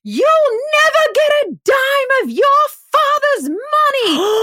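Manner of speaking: very high, slow Southern mama tirade; shrieked Southern-belle threat; hammed-up Steel Magnolias Southern. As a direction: imitate a furious Southern mother, drawing out every word. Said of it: "She goes full steel magnolias".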